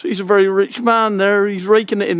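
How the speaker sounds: ordinary delivery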